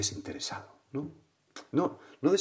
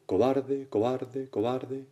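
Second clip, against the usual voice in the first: higher repeats, fading each time; imitated echo; 4 semitones higher, much slower than usual. Mountain echo returns the boy's insult, fading echo. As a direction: send the shout bouncing back, dying away. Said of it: "Y, y el eco".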